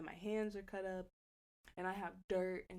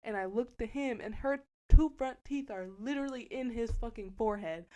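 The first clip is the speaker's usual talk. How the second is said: ordinary talk, nothing marked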